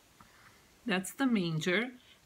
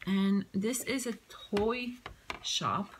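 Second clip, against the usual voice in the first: normal talking voice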